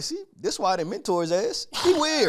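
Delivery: a veteran gloating, climbing to a high jab; Brett Favre mocking. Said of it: "I'm telling everybody".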